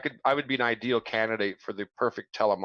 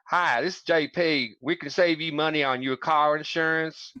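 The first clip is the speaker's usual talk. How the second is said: put-on country accent; 6 semitones higher than usual. Shown as a demonstration. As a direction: telemarketer pitch in an exaggerated country accent. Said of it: "that would appeal to a certain class of people".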